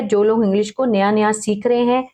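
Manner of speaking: ordinary talk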